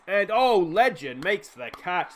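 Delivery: amazed commentator's outburst, stretched out; excited catch call. Real, not acted. Commentator sees Legend make the catch, excited.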